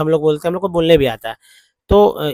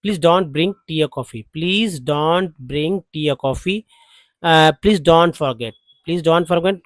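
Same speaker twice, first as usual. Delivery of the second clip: slow, measured model sentence; drill repetition; much slower than usual. Shown as a demonstration. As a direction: repeat it slowly for learners. Teacher repeats model sentences for practice. (धीरे-धीरे दोहराकर अभ्यास कराते हुए)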